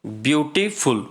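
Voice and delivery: ordinary talk, nothing marked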